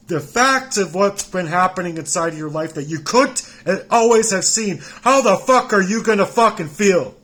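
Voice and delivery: furious shout. Real, not acted. Ranting at the people who ignored his life, furious shout. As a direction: scream it at them in raw fury. Furious rant at unseen listener.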